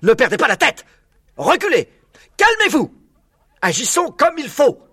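loud, high commanding shouts; urgent orders. A narrator's reading. Father fox orders excited cubs to calm down, urgent shouts. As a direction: rein them in forcefully. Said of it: "ordonna Maître Renard".

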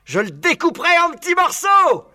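cri aigu et fort, hargneux; aboiement rageur. A narrator's reading. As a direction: aboyer la menace. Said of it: "aboyait Buns". (Buns menace le renard en aboyant)

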